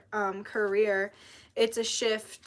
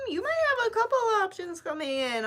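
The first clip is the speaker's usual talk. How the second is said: high sing-song; 11 semitones higher than usual. Real, not acted. Repeats the same line.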